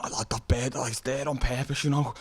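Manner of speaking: light Liverpool Scouse voice, rising; hint of a Scouse accent. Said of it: "I thought it was Scouse accent".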